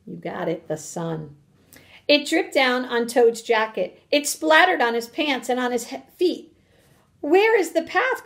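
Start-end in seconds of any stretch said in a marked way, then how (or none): 2.1-6.6 animated, emphatic storybook reading; story read-aloud to children. Acted. 7.2-8.2 high-pitched storybook wail; acted high, helpless call; distressed cry. A narrator's reading. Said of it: "cried Toad".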